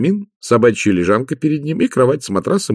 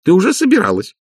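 high-pitched, indignant telling-off; see right through her and say so; scolding; 10 semitones higher than usual. Acted. Magrat accuses Nanny of planning a crude remark, high and scolding.

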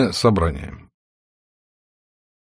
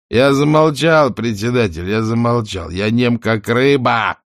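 higher, slow jolly mocking shout; cheerful shout; 4 semitones higher, 4 dB louder than usual. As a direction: shout it out merrily. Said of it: "крикнул он весёлым голосом".

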